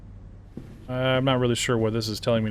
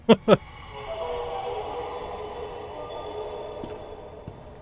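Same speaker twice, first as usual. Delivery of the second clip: high squealing laugh; 5 dB quieter than usual. Real, not acted. Laughs at weird level.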